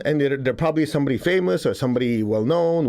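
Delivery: ordinary delivery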